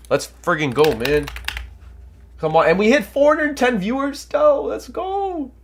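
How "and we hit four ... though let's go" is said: rising excited cheer, much higher. Real, not acted. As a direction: hype it up, voice climbing high. Celebrating viewer count milestone.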